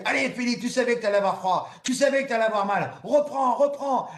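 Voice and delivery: high, loud shouted encouragement; team yelling. Retold or imitated. Crew on the boat yell at the swimmer, loud and high. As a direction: yell at him to keep going. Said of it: "mon équipe qui me gueule dessus".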